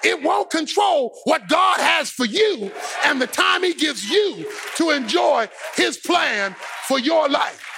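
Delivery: ordinary talk, nothing marked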